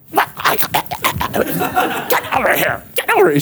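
hammed-up possessed mom, rapid-fire; mock-demonic rush of an enraged mother; mom in manifestation. Mom seeing the button on her son, hammed-up manifestation. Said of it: "she goes into manifestation".

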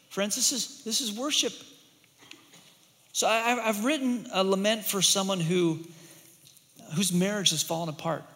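ordinary talk

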